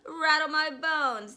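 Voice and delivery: high, loud spooky parrot call; slow, high ghostly imitation. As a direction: draw it out loudly like a spooky parrot. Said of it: "giving a wonderful imitation of a spooky voice".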